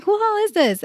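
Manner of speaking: high incredulous question; shocked. Retold or imitated. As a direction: ask in disbelief. Retold shocked reaction.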